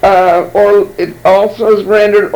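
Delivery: ordinary talk, nothing marked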